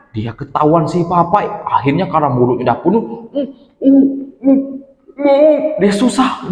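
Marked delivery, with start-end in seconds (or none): 3.3-5.6 high muffled hums through a full mouth; mumbled hums. Acted. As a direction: try to talk with cheeks stuffed. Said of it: "dia mangap-mangap ngomong". (gumaman mulut penuh)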